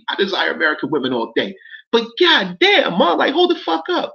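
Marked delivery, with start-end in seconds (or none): 1.9-4.1 real exasperation, pitched up; exasperated outburst. Real, not acted. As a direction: voice leaps up in disbelief. Outraged at lyric.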